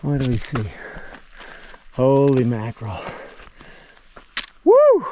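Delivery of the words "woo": very high, loud yelp of joy; joyful whoop. Real, not acted. Hiker reaching the summit view, very high loud whoop.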